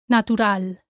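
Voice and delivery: Natural as na-too-RAL; stress on A. Shown as a demonstration. Teacher models for learners, Natural stressed on the A. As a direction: punch the final A in Natural. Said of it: "stress falls on the A".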